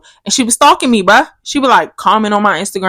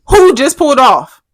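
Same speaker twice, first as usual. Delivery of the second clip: angry demand; 8 semitones higher, 6 dB louder than usual. Retold or imitated. Confronting cheating boyfriend.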